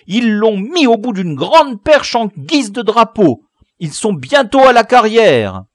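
very high, very loud furious howl; full-throated outraged shouting; enraged yelling. A narrator's reading. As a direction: scream the news down in a rage. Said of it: "Tougueul hurla plein de rage".

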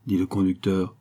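ordinary delivery, nothing marked

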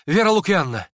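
high, loud, urgent call; hurried summons. A narrator's reading. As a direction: call her over impatiently. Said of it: "торопливо пригласил Ипполит".

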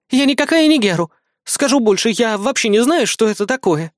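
high-pitched female denial; high, firm bodyguard voice; indignant Kri. Acted. Кри возражает мальчику.

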